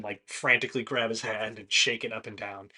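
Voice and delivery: ordinary delivery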